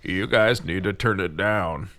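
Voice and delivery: low, slow old-man imitation; complaining old man. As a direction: mimic a slow, grumpy old neighbour.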